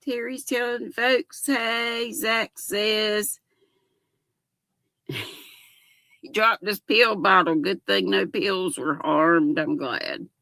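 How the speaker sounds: ordinary talk, nothing marked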